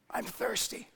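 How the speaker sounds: raspy, breathless whisper; strained whisper. Acted. Dying Jesus whispering that he is thirsty. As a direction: push the words out on a dry throat. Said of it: "whispered as loudly as he could".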